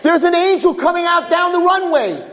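high shout of Wait; screaming announcement. Acted. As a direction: call out the arrival. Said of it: "starts screaming".

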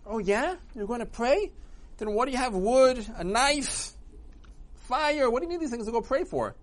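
high, prodding interrogation; suspicious questioning. Acted. Satan grills Avraham suspiciously.

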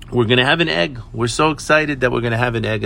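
ordinary talk, nothing marked

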